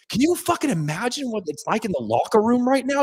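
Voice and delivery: giddy post-win hype; real excitement. Real, not acted. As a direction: let the excitement run away with you. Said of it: "I am so fucking jacked up right now".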